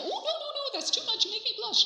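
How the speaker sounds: high, fluttering protest; flustered waitress. Acted. Waitress protesting the tip, acted.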